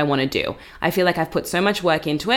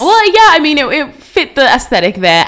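excited; 8 semitones higher, 7 dB louder than usual. Real, not acted. Defensive laughing reply.

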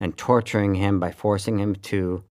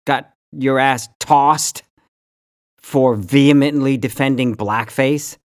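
raised, louder angry rant, much higher; angry callout; 4 semitones higher, 5 dB louder than usual. Real, not acted. Host yelling straight at Megyn Kelly, angry. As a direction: shout her down with contempt.

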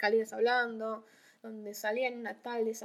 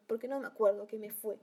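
ordinary talk, nothing marked

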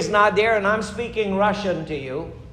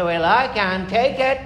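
mock complainer; 2 semitones higher, 4 dB louder, slower than usual. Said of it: "Somebody say".

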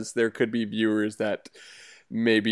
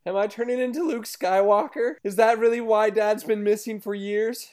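put-on higher, childlike son voice; kid voice; 9 semitones higher than usual. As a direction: play a sheepish young boy. Son speaks in sketch.

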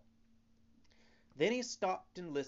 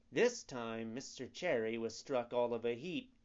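ordinary delivery, nothing marked

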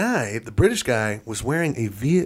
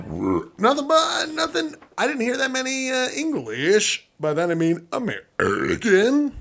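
high put-on voice; 10 semitones higher than usual. Joke voice about hearing accents.

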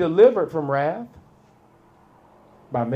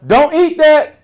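sudden loud, high yell; 11 semitones higher, 11 dB louder than usual. Pretend. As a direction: shout a warning across the room. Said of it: "and I just yell".

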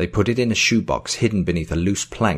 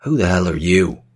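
loud, indignant demand; 5 dB louder than usual. A narrator's reading. Joel confronts the stranger in his house, demanding. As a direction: demand it forcefully, louder. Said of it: "Joel demanded".

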